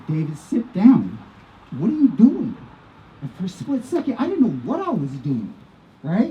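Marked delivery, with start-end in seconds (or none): 0.1-2.6 imitating a wife's sharp order, louder; retold wifely scolding. Wife grabbing her husband by the collar, mildly acted scolding. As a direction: scold him, rising into an exasperated question.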